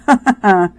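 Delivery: self-amused laugh. Real, not acted. Crafter amused by own fingerprint rant, short loud laugh. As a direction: burst out laughing briefly at yourself.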